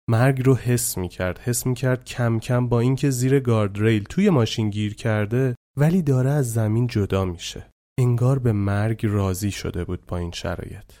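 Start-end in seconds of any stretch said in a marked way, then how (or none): none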